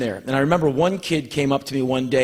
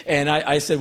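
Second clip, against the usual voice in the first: usual speaking voice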